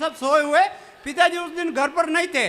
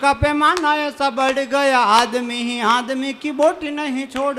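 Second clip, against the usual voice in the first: poem recital; 4 semitones lower than usual. Acted. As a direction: declaim the verse gravely. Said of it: "चार पंक्तियां पढ़ता हूँ".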